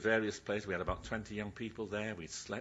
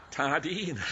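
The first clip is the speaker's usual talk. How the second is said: Tardine laughed out loud and louder; laughing Tardine; 4 semitones higher, 6 dB louder than usual. Retold or imitated. Preacher laughing at Wilfred's Devon Tardine. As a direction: laugh through the word Tardine. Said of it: "Different language".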